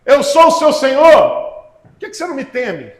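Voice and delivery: voz de Deus repreendendo, alta e lenta nas perguntas; pregação inflamada. Acted. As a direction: pregue como Deus cobrando o povo. Preacher voicing God's reproach. (pregador dando voz a Deus, em tom forte de repreensão)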